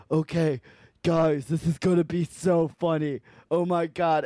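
lightly voiced loudmouth girl; loudmouth twin. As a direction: imitate her hyping up her prank. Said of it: "they're all loudmouth".

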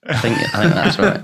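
laughing while agreeing. Real, not acted. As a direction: chuckle through the words. Laughing at Moria joke.

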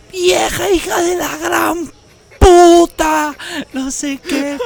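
high, slow, hammed-up furious yell; loud mock screaming mom at another driver. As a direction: shout the insult like an enraged mother. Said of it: "escuchando a mi mamá gritar".

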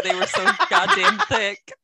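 laughing it out. Real, not acted. Laughing mockery of jeans.

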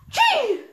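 loud high cough burst; explosive cough. Acted. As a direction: blast it out like a huge cough. Monster cough sprays slime.

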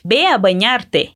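"Ve a bañarte" slowly, V as B; slow Spanish model. Shown as a demonstration. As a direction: say "Ve" like "be", carefully. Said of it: "o V tem som de B".

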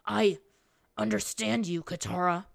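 halting, lightly strained speech; through clenched teeth. A narrator's reading. Captive pleading understanding to his captor, strained. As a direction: push the words out with effort. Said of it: "he said through clenched teeth".

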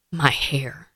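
whispered, half-voiced; mother whispering her fear to her husband; retold whisper. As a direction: breathe the words out like a secret fear. Retold plea to husband Daniel.

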